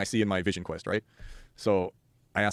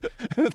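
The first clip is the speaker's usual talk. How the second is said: high-pitched mock laugh; silly high laugh, retold; imitated tripping laugh; 12 semitones higher than usual. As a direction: act out a drooling, helpless giggle. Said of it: "I'm drooling. I'm like".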